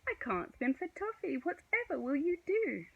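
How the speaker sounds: high, worried wail; distressed. A narrator's reading. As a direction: fret loudly, voice climbing. Stu frets in the water.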